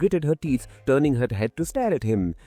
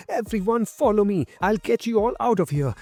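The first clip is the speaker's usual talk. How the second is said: high-pitched rallying shout; rally the crowd behind you; mild, urgent shout; 7 semitones higher than usual. A narrator's reading.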